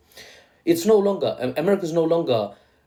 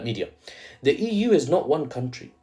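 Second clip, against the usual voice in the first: ordinary talk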